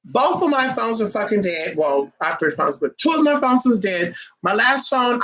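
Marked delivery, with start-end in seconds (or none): none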